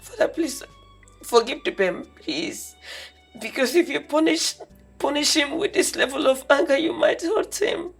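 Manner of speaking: soft tearful pleading. Acted. Daughter begs her father to forgive them. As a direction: beg them for mercy.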